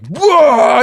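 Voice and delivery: loud, high imitated retch; projectile vomiting noise. Man vomiting into the trash can, loud imitated heave. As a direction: heave out a long mock vomit roar. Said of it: "starts projectile vomiting".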